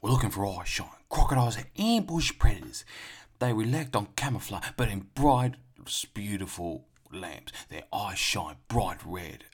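hyped-up Aussie wildlife host impression; Steve Irwin. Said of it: "From the quote".

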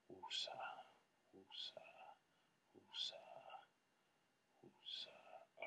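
slow calming exhale, whispered; self-calming whisper. Real, not acted. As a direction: breathe it out to calm down. Calming himself down after anger.

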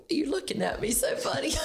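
laughing. Real, not acted. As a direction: try to talk through the giggles. She breaks up at his look.

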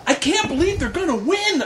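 put-on the excited child watching the game; high childlike amazement; imitating young son. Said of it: "George is going".

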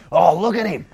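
loud, high safari-host Aussie accent; hammed-up Aussie wildlife-hunter voice; exaggerated Australian accent. Shown as a demonstration. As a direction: belt it out in broad excited Australian. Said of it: "That's what this accent is".

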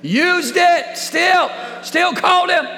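very high, loud preacher's cry; full-force preaching. Real, not acted. Preacher insisting God still uses flawed people, shouted preaching. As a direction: shout it out to the whole church.

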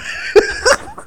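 high airy disbelieving laugh; high breathless laugh. Real, not acted. Preacher laughing at the kids' refusal, high and airy. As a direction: let out a high, voiceless laugh.

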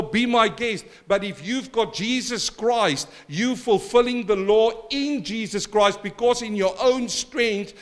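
fervent preacher; preaching. Acted. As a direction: drive the point home from the pulpit. Preaching with force.

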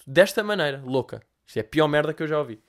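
ordinary talk, nothing marked

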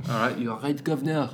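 British greeting in a mock French accent; badly faked French accent. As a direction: put on a silly French accent. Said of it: "no sense in a French accent".

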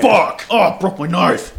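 acted angry outburst. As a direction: curse like the exasperated character. Acts out character's angry first words.

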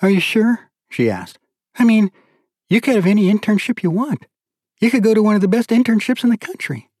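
a hint of a pleading wife pressing her point; lightly voiced wife urging husband to take the internship; young wife, eager.

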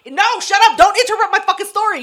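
loud, high, fast outburst; yelling. Real, not acted. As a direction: shout him down to keep talking. Cuts off co-host mid-story.

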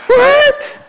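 put-on high, puzzled teenage "What?"; mock teenage girl. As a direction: play a confused teen girl. Girls answering again.

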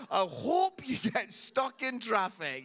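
loud acted heckle; mock angry shout. Pastor's curse on rival fans, acted again, mock shouting. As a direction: bellow it as a harmless curse. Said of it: "shouts through the fence".

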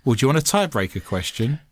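show host voice. Acted. Show host sign-off read.

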